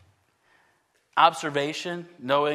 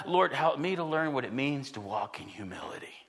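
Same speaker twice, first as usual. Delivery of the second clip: soft, unvoiced plea to the Lord; whispered prayer; 5 semitones lower, 7 dB quieter than usual. Retold personal prayer to the Lord.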